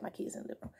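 unvoiced whispered aside. Real, not acted. Aside about kids in next room.

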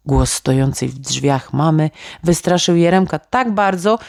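ordinary delivery, nothing marked

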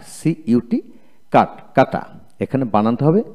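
ordinary delivery

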